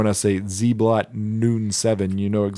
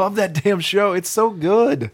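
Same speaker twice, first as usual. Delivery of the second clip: raised, excited fan gush; gushing enthusiasm; 8 semitones higher, 3 dB louder than usual. Real, not acted. Fan raving about Bob's Burgers, excited. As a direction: rave about a favorite, voice jumping up.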